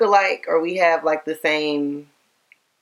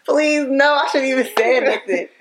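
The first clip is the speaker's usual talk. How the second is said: embarrassed laughing protest, higher pitched; laughing plea; 6 semitones higher than usual. Real, not acted. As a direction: plead through laughter. Embarrassed plea about an old picture.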